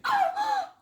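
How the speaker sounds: high, startled gasp; sharp gasp. Acted. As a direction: gasp in sudden fright. Elsie sees axe overhead.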